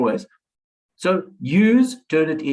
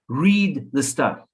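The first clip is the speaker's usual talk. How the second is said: slow right down and press each word; slow emphatic command; much slower than usual. Shown as a demonstration. Lecturer insisting students read everything, very slow. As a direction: read the stuff, drawn out word by word. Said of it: "I can't stress this enough".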